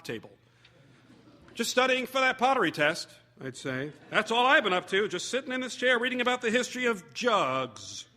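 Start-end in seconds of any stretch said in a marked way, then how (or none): 1.5-3.1 higher, louder, guiltily innocent; guilty excuse. Retold or imitated. To roommate walking in, guilty excuse. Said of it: "sounding as if I were guilty". 4.1-8.1 higher, louder, guiltily innocent; guilty excuse. Retold or imitated. To roommate walking in, guilty excuse. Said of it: "sounding as if I were guilty".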